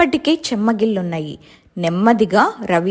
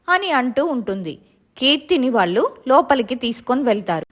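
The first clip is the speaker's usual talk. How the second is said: usual speaking voice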